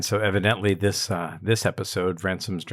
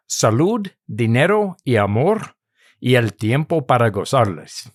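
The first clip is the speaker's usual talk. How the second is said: Spanish toast with a real Castilian lilt, louder and raised; Spanish accent; 2 semitones higher, 7 dB louder than usual. Retold or imitated. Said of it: "That's a pretty good accent".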